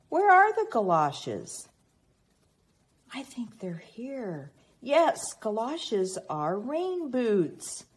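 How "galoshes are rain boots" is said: plain reading voice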